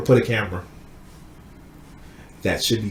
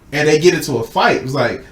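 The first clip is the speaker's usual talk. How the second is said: ordinary delivery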